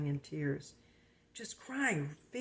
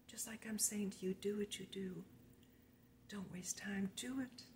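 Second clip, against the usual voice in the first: urgent whisper; 4 semitones higher, 9 dB quieter than usual. Acted. Urging viewers to act now.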